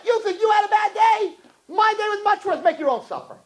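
very high, strident tirade; indignant wife. Acted. Wife outdoing husband's bad day, high indignant rant.

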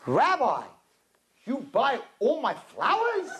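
imitated excited florist, drawn-out rising question; excited Korean florist. Korean florist asks the rabbi about flowers, imitated excitement. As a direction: ask slowly, rising high with delighted hope. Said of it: "He was so excited".

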